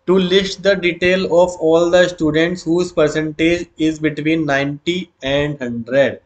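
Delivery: very slow, word by word; slow dictation. Shown as a demonstration. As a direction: spell it out for students to copy. Teacher reading question aloud.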